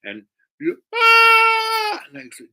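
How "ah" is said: loud high falsetto cry; hammed-up squealing sob; overwhelmed wail. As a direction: burst out in a long mock wail. Girl overcome by the offer.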